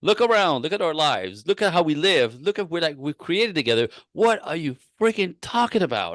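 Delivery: imitating husband's frustrated rant over a cup. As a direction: play the fed-up husband lifting the cup. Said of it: "So very frustrated".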